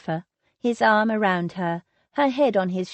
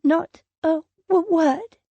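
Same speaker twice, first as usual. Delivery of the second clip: higher, stammering, trembling; 8 semitones higher, 4 dB louder than usual. A narrator's reading. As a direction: let the voice shake on word. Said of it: "Her voice trembled".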